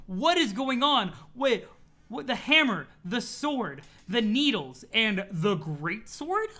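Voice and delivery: baffled astonishment. Real, not acted. Reader listing the weapons turning up in the story, astonished. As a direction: punch each item in growing bewilderment.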